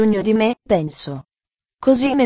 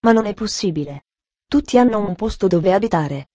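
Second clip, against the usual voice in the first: usual speaking voice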